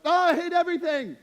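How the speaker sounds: loud, high hammed-up outburst; over-the-top high-pitched tantrum; mock-exasperated hyperbole. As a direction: yell it in exaggerated frustration. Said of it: "This is just hyperbole".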